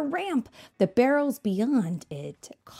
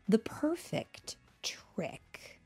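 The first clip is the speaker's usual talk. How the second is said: hushed title; 4 semitones higher, 8 dB quieter, much slower than usual. Acted. Announcing the story title.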